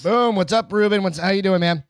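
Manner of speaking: hyped welcome; excited greeting. Real, not acted. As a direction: call out Ruben's name with energy. Greets a new viewer loudly.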